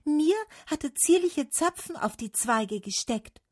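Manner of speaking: high-pitched little boast; calling out. A narrator's reading. Larch praising Summer's cones, calling out high. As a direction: pipe up in a high voice. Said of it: "rief die Lerche".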